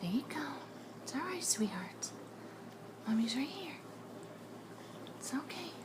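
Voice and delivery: hushed reassurance, half whispered. Real, not acted. Mother comforts son after seizure.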